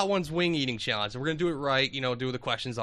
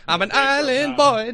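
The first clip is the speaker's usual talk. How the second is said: high, slow meme chant; drawn-out meme imitation; island boy meme voice; 10 semitones higher, 8 dB louder than usual.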